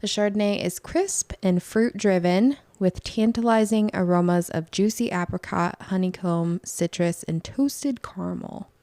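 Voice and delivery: presenter's emphatic read-aloud; scripted podcast read. Acted. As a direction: read the tasting notes out like an announcer. Scripted intro and wine description.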